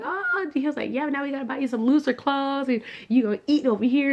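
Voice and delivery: high, lively excited voice; happy dad already making plans; dad delighted. Retold or imitated. Dad hearing about the twins, high excited voice.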